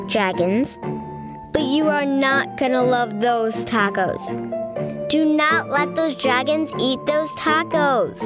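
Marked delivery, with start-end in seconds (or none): none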